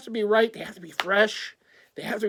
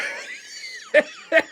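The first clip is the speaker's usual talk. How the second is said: laughing. Real, not acted. Laughing hard.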